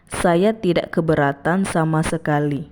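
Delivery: ordinary delivery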